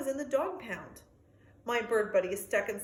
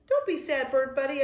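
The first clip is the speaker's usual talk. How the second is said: barked comfort; 3 semitones higher, 6 dB louder than usual. Acted. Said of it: "I bark back to him".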